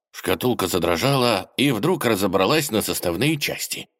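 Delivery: ordinary delivery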